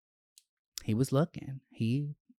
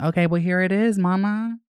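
excited showing off; 6 semitones higher, 4 dB louder than usual. Real, not acted. Presenting the ashtray excitedly.

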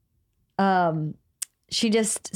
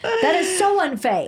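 laughing mock outrage; 10 semitones higher than usual. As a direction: squeal "unfae" while cracking up. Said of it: "F A I E".

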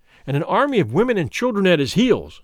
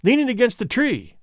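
much higher, lively pointing out; eager exclamation; 7 semitones higher than usual. A narrator's reading. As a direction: excitedly point him out to the stranger. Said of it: "exclaimed two or three".

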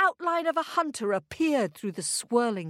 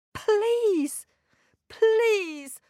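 high, drawn plea; begging boy; 10 semitones higher, 4 dB louder than usual. A narrator's reading. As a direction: beg as a desperate boy. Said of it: "begged the boy".

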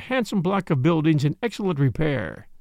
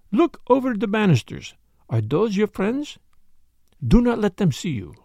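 put-on higher French-accented detective giving orders; Poirot, urgent instructions; 5 semitones higher than usual. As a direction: direct your friend urgently, French accent.

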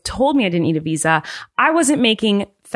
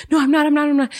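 panicked denial; 8 semitones higher, faster than usual. Retold or imitated. Performer denying to the mean officer, frantic and high. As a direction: plead your innocence in a hurry.

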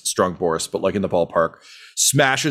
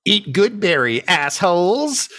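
higher, slow taunting goofball voice; goofy mock voice; 9 semitones higher than usual. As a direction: sneer like a dim wizard. Goofy caster taunting enemies.

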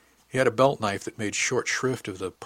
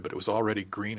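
ordinary delivery

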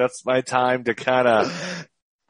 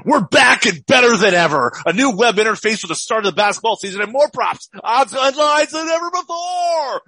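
loud, high, hyped radio DJ; morning zoo radio voice; 10 semitones higher, 4 dB louder than usual. Acted. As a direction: shout it like a morning radio host. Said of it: "I'm gonna do this morning zoo, morning zoo radio here".

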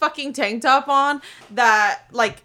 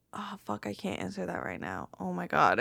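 near-whispered dream panic, much softer; hushed panic; 4 semitones lower, 13 dB quieter than usual. Retold or imitated. Lying on the massage table, panicking in hushed tones. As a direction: hiss it out, scared to be overheard.

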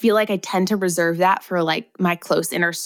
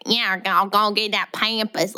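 slow, mocking Southern drawl; hammed-up Southern twang, vowels stretched; Southern accent; 2 semitones higher, slower than usual. Co-host mocking how pampas sounds, slow Southern accent. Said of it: "Southern accents sound that way".

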